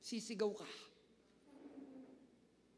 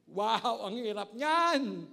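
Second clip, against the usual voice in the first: exclaiming; 8 dB louder, much slower than usual. Real, not acted. Preacher reacting to hard command.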